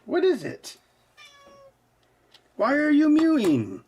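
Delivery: cat-talk voice, way up high. Real, not acted. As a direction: coo up at the kitty.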